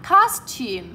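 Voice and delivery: teacher's model of costume, first syllable stressed. Shown as a demonstration. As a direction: hit COS in costume, let -tume drop. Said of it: "lên giọng ở âm số một và xuống giọng".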